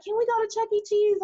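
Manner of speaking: put-on high, pleading child's voice; little daughter asking. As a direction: beg sweetly like a small kid. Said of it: "My daughter be like".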